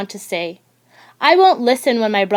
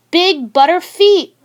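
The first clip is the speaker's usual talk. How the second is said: higher, louder schoolyard taunt; taunting yell; 7 semitones higher, 5 dB louder than usual. Acted. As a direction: holler it to mock him. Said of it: "yells Carlos".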